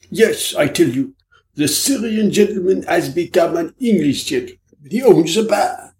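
a Frenchman teasing his friends, climbing up high; French detective Hanaud. Acted. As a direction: play the sly foreign detective springing a joke.

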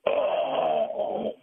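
high, breathless mock yelp of pain; acted yelp of pain. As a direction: cry out as if kicked hard in the shin. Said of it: "and you go".

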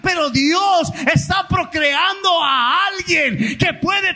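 impassioned pastor preaching at full voice; full-force preaching. Real, not acted. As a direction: preach it out to the congregation, building.

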